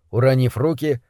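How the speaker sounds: plain reading voice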